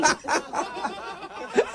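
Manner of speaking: bursting into high laughter; high breathy laugh. Real, not acted. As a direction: crack up at the reveal. Prank host breaks up laughing.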